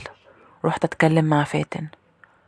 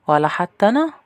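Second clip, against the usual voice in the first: higher questioning maid voice, rising at the end; eavesdropping maid; 4 semitones higher, 4 dB louder than usual. Acted. Maid suddenly appears and questions the sisters, higher rising voice. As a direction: cut in with a pointed, rising question. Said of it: "ده كان صوت الخدامة".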